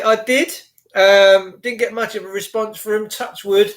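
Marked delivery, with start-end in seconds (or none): none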